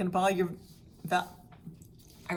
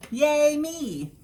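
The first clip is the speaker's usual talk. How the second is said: higher, louder mock cheer for herself; 9 semitones higher, 9 dB louder than usual. Real, not acted. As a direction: toot your own horn. Celebrating being chemo-free.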